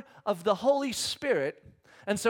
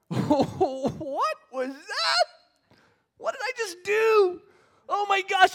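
stunned disbelief; 12 semitones higher, 5 dB louder, much slower than usual. Retold or imitated. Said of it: "kinda look around going".

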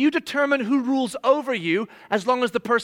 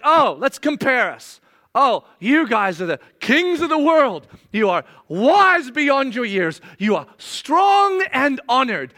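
louder, slow, dripping irony; mock-flattering angry sarcasm; sarcastic mock praise; 4 dB louder than usual. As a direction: lay the sarcasm on thick, as an angry apostle. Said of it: "I love when Paul gets angry".

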